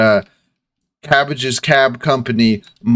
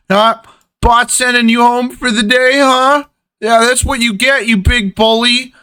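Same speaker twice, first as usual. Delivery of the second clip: gloating clerk; 11 semitones higher than usual. Acted. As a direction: taunt the bully from a safe distance. GM voices gloating clerk NPC.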